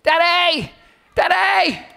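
high, loud repeated call; kids calling Daddy. Retold or imitated. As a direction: call for Daddy across the park. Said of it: "They're going".

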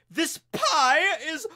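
high, slow, whiny critic voice; snooty food critic. Pretend. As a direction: sneer it like a snobby gourmet. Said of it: "That's how I imagine he talks".

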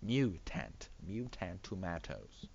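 "Mutant" split into MYOO-tant; deliberate myoo and full "tant" in "Mutant"; corrected pronunciation. Shown as a demonstration. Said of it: "It's mu".

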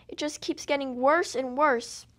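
ordinary delivery

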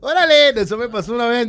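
put-on a touch of the Mexico City chilango accent carried into the story. Opening his story still in a chilango accent. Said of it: "el chilango".

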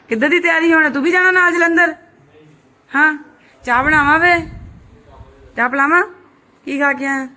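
high, loud hosting aunty voice; dramatic loud aunty. Real, not acted. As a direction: pitch up and tease the guest loudly. Loud teasing questions to guest.

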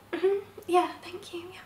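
high, soft mm-hmm that fades to a whisper; whispered yes to the colleagues; fake-fine murmur. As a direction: brush it off, barely voiced. Pretending to agree, holding it together.

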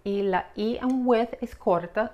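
ordinary talk

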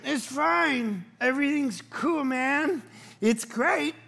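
high, put-on easygoing reassurance; mock Christlike calm. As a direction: act forcedly cool about the mess. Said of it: "And so I was like Jesus".

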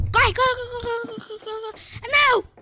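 high panicked urging to run; frantic, running for it; being chased. Real, not acted. Fleeing the baby in game. (fugindo em pânico)

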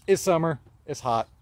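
usual speaking voice

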